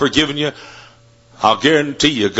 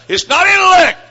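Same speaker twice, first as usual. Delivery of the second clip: preaching peak; 5 dB louder than usual. Acted. Sermon climax.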